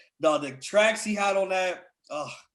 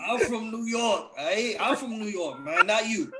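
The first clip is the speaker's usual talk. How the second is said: badly faked New York accent, pitched up; failed New York accent; 5 semitones higher than usual. Defending himself to mocking friends, failed New York accent. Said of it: "That's not a New York accent".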